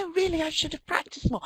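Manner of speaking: high-pitched acted modesty; self-deprecating protest. Brit compelled to turn down American praise, acted self-deprecation. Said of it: "then I'm gonna have to say".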